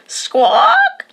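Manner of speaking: high piercing squawk cry; shouted squawk. A narrator's reading. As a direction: squawk it out high and loud like a bird. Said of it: "Maria shouted".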